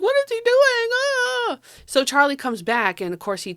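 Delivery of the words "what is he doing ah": high, startled cry of alarm; panicked high-pitched yelp; alarmed shriek. Retold or imitated. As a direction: shriek it out, shocked at what he's doing. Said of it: "And they're like".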